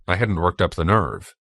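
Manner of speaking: usual speaking voice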